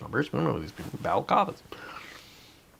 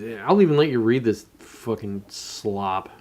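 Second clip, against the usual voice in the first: ordinary talk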